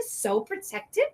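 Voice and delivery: normal talking voice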